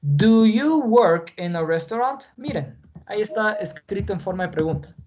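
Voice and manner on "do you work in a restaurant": slow, careful read for learners; teacher reading a model question slowly; slow model sentence. Shown as a demonstration. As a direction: spell it out word by word. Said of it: "Les escribí estos ejemplos".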